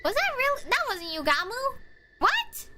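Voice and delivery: high, stunned disbelief; shocked. Real, not acted. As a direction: gasp out the questions in shock. Shocked at killer reveal.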